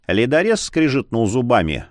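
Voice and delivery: ordinary delivery, nothing marked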